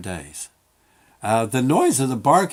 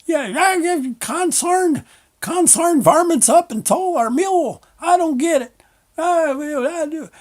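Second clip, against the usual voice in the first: put-on high, creaky old-timer from a western; old prospector; 6 dB louder than usual. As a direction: play the grizzled prospector in an old movie. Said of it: "I'm kinda getting like that old prospector in the old movies".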